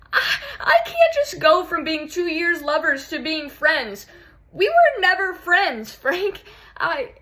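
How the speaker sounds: high, loud, tearful outburst; anguished protest. Acted. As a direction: protest through rising tears. Breaking down over the breakup.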